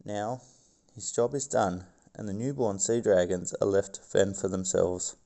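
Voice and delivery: documentary narrator voice; nature documentary. Acted. Reads documentary script.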